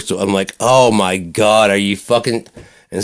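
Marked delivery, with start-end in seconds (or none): 0.6-2.5 exasperated disbelief. Retold or imitated. Outraged at cousin beating kid.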